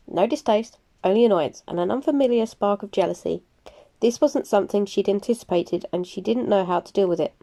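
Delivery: plain reading voice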